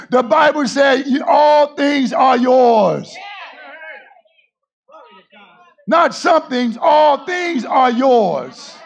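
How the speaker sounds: preacher hammering "all" to the church; stress on all. Shown as a demonstration. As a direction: drag out "all things are yours", leaning on all. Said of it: "slow emphatic scripture".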